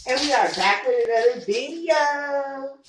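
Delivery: high, loud, drawn-out hype greeting; excited YouTuber intro; upbeat vlog intro. Real, not acted. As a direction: open the video big and sing-song, stretching the last words. Hyped video intro greeting.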